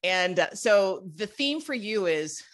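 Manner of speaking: ordinary delivery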